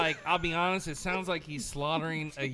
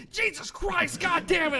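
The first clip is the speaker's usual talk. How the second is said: mock cursing yell, higher and louder; imitated pained yelling; 12 semitones higher, 7 dB louder than usual. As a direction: bellow it like a man in agony, half joking. Imitating friend yelling in pain.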